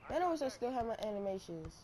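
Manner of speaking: ordinary talk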